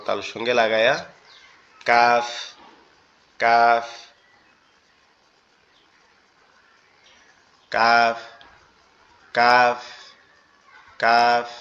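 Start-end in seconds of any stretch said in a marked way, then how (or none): 1.9-11.5 কাফ repeated slowly as a clear teaching demo; kaf pronunciation demo. Shown as a demonstration. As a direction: model কাফ with a firm k from the tongue's back. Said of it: "এরকম হবে".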